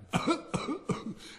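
high, breathy affected cough; haughty little cough; pompous cough. A narrator's reading. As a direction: cough for attention. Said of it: "the rocket coughed a third time".

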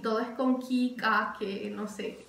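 ordinary delivery

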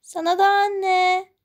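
put-on a girl feigning innocence to mother; sweet little girl. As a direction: play the innocent daughter. Girl goodnight.

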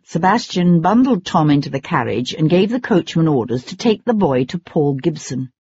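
ordinary delivery, nothing marked

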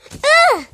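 loud, high ugh of pain; sudden loud yelp. Real, not acted. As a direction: cry out as you hit the ground. Falls down.

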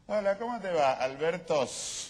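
high, loud imitation of a friend's hello; mock friendly greeting. Colleague imitating his friends greeting him, mock high hello. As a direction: mimic a pal's greeting, lingering on the false plural. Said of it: "sus amigos le dicen".

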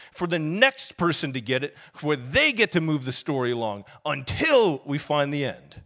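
loud, rising preaching voice. Acted. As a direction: preach it with full force.

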